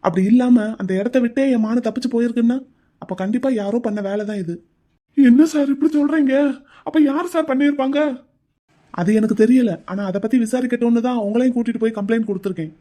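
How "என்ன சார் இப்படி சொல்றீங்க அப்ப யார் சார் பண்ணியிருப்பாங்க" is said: higher, louder stunned question; shocked disbelief. Acted. Brother hears sister's fall was no accident, shocked. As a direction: blurt it out in shock.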